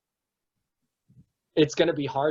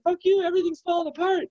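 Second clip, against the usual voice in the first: much higher, fast frantic outburst; hammed-up furious panic; mock panicked rage; faster than usual. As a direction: lash out in panic. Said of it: "He's like".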